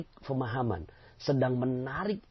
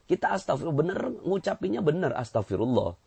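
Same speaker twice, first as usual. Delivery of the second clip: usual speaking voice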